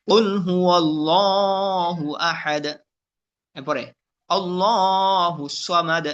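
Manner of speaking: slow, measured tajweed chant; Quran recitation. Shown as a demonstration. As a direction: recite with drawn-out vowels. Recitation demo.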